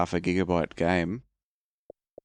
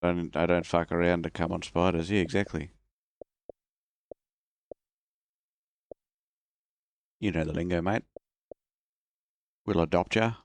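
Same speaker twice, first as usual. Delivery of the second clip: bad Australian accent. Announcing bad accent time, then playing an Aussie bloke. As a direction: lay on a cheesy Australian accent. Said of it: "Bad accent time".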